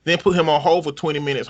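ordinary talk

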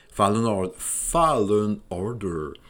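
English with Quebec accent. Real, not acted. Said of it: "il doit rire de mon accent".

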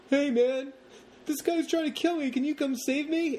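high, squeaky mock plea for rescue; mocking girlfriend plea. Girlfriend calling her boyfriend, high mock helpless plea. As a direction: pitch way up, play a helpless damsel. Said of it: "and says".

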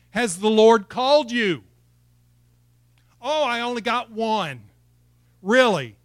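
loud, high-pitched rousing preacher; full-force preaching. Real, not acted. Preacher asking whether the Lord called you, loud and rousing.

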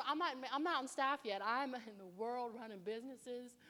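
normal talking voice

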